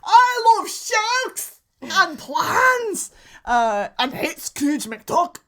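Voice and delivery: loud, high, slow cartoon Scottish accent; Flintard Glomgold. Shown as a demonstration. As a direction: bellow in a hammed-up Scottish accent. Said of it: "Flintard Glomgold is my Scottish accent".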